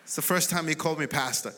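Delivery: ordinary talk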